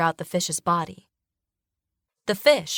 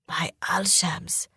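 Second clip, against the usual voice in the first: breathy hushed whisper, slow; 4 dB quieter, slower than usual. A narrator's reading. Frightened sailor whispering a prayer. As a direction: whisper it in awe and fear. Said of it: "he whispers".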